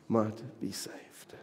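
slow breathy whisper; hushed, slow ending. Acted. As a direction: let it fall to a whisper. End of John 3:17.

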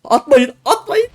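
squealing frantic plea; pleading squeal. Real, not acted. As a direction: plead desperately not to be knocked off. Begging enemies not to hit.

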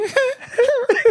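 tiny, very high-pitched moaning squeak; comic falsetto moan; Mickey Mouse moan. Retold or imitated. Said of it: "sound like Mickey Mouse if I'm moaning".

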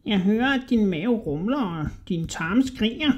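high, squeaky, wheedling rat voice; sly little rat man. A narrator's reading. Little rat flattering a hungry Hodja, high and squeaky.

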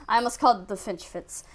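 ordinary delivery

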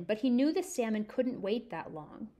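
plain reading voice

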